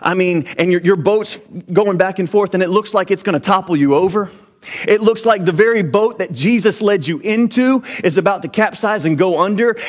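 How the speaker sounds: ordinary talk, nothing marked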